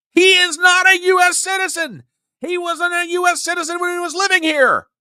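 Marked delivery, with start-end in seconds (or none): 0.1-4.0 emphatic shout. Real, not acted. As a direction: declare it as a fact you are sick of repeating. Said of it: "you guys are hearing me say".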